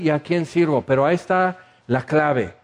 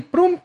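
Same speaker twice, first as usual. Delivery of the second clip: sharp high "prum" impact noise; a high comic "prum!" for God striking Paul down; 13 semitones higher than usual. Retold or imitated. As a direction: burst out a high mock crash sound.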